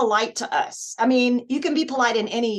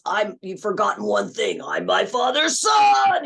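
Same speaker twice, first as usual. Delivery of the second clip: Gohan; 5 dB louder than usual. Shown as a demonstration. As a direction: start firm, then yell the last word long. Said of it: "And then for Gohan".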